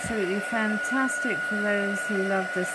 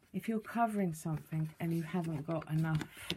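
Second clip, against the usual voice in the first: ordinary talk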